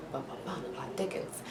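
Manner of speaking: hushed dramatic murmur; hushed suspense. Pretend. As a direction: tease the twist in a hush.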